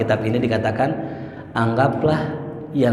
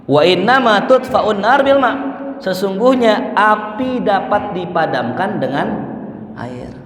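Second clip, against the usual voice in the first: fervent hadith recitation; 7 semitones higher than usual. Real, not acted. Preacher quoting the hadith.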